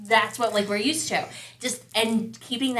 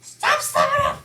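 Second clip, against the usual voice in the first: yelling at daughter; 3 dB louder than usual. Retold or imitated. Parent yelling at Lena to stop slamming doors. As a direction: stretch the words out, fed up. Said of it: "we'll be like".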